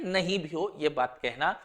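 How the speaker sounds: ordinary talk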